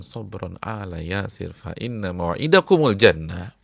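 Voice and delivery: loud, slow, rising declaration; ringing proclamation. Acted. Preacher proclaiming the Prophet's promise to Yasir's family, loudly.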